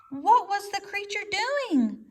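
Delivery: a nervous weasel asking. A narrator's reading. Weasel asks the owl, high anxious voice. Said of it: "said Dart the weasel".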